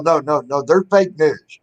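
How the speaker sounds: normal talking voice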